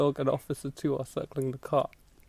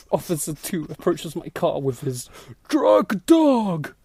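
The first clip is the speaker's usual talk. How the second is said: ordinary talk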